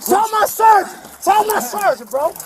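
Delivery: shouting at the top of his lungs; desperate shout. Real, not acted. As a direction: yell it out in panic. Man shouting during arrest.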